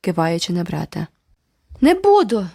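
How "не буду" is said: loud, high angry shout; furious cry. A narrator's reading. Boy shouting at the girl, furious. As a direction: yell it in fury. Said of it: "люто скрикнув Джеральд".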